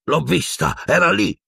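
high, angry insistence; furious. A narrator's reading. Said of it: "disse il boia furibondo". (furibondo, sempre più acuto)

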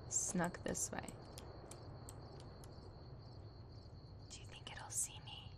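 scared hushed whisper. Real, not acted. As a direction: whisper as if hiding. Sneaking past a creature.

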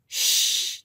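long, voiceless ghostly shush; whispered shush. A narrator's reading. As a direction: hush them with a whispered shh. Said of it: "they whispered".

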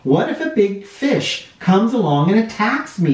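half-scared wondering; a hint of fear in open water; mild worry. A narrator's reading. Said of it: "frightening out in the open sea".

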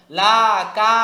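"লাকা" with both "লা" and "কা" held long; wrongly stretched vowels. Shown as a demonstration. Teacher showing what not to say, "লাকা" with long vowels. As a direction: stretch each syllable of "লাকা". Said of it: "এরকম উচ্চারণ করা যাবে না".

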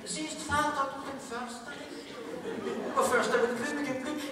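mock-dramatic little tree; frightened fir tree. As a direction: plead up high like the child in the Erlking. Fir tree's voice in Erlking parody.

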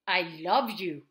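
loud, low, forceful yell; yelled, angry. Shown as a demonstration. Showing that tone goes unheard, love yelled loudly. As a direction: bellow it in a low voice. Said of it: "if you are yelling or if you're whispering".

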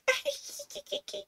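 high mock "kiki" giggle; giggling imitation. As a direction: imitate grown men tittering like kids. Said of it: "they would, like".

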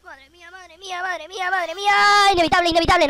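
shrill rising panic; frantic high voice. Real, not acted. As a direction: squeal it in disbelief.

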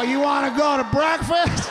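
put-on high, slow, loose drunk holler; drunken invitation. Drunk husband to angry wife, oblivious cheery holler. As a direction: call it out like a clueless happy drunk.

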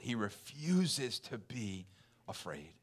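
slow, dropping to near silence on afraid; fading to a hush. Real, not acted. Emphatic fading scripture point.

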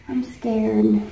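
scared little whisper; a hushed I'm scared under the bird. Real, not acted. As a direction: whisper it, half joking. Parrot on her head.

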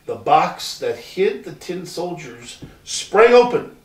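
ordinary delivery

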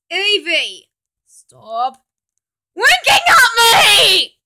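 high, loud, slow yelling; flustered yell. Acted. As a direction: shriek at the flirting.

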